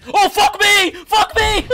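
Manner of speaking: high, loud startled yell; panicked shout. Real, not acted. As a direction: yell it in a sudden fright. Sudden scare in the game.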